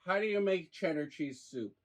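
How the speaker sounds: ordinary talk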